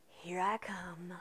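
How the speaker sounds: slow hushed whisper. Real, not acted. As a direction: breathe it out secretly. About to eat bread.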